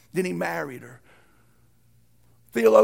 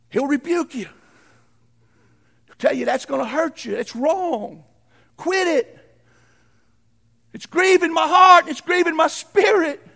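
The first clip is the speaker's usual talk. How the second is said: rising to a high anguished shout; God's pained rebuke; 8 semitones higher, 8 dB louder than usual. Acted. Preacher voicing God's grieving rebuke, building to a shout. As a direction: cry out as a grieved father. God voiced rebuking the believer.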